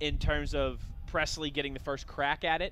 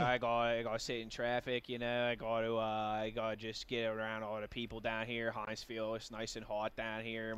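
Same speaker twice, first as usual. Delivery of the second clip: put-on much lower and softer, grumbling old grouch; curmudgeon voice; 7 semitones lower, 6 dB quieter than usual. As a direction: mutter your complaints like a cranky old man. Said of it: "My curmudgeon voice".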